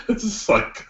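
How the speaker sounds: giggling breathily through the words; can't hold back a laugh. Real, not acted. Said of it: "I just start laughing".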